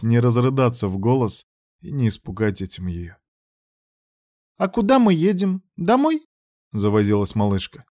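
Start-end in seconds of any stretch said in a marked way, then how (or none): 4.6-6.2 put-on little girl voice. A narrator's reading. Child asks eagerly.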